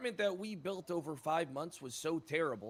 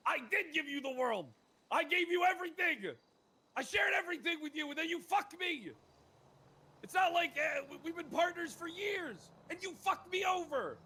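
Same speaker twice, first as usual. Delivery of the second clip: emotional outburst; 7 semitones higher than usual. Acted. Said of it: "you were getting pretty emotional there".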